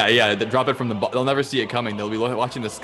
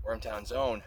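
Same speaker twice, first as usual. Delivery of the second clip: mock ad read; 3 semitones higher, 3 dB quieter than usual. Fake sponsor beer ad read.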